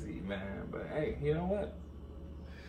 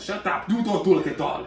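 higher, louder mock scolding; mock bossy; 6 semitones higher, 8 dB louder than usual. Stylist forbidding the client to look, mock bossy. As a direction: cut the client off in pretend outrage.

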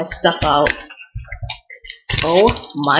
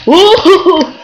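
cheering; 10 semitones higher, 7 dB louder than usual. Real, not acted. Happy cheer in game.

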